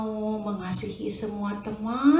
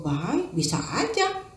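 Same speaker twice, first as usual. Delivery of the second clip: usual speaking voice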